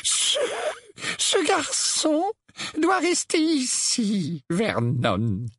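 high, slow, feeble quaver; faint voice. A narrator's reading. Aunt Petunia telling her husband the boy stays, faint voice. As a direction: squeeze it out weakly, word by word. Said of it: "d'une voix faible".

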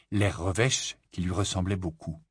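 usual speaking voice

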